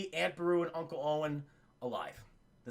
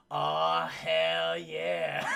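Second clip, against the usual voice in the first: much lower, gruff pleased growl; deep old hermit; much slower than usual. Retold or imitated. Old Ben finding Luke, much lower and pleased.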